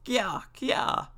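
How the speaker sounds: high, imitated monkey chatter; two high monkey cries; monkey call. A narrator's reading. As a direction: squeal like a storybook monkey. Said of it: "says the monkey".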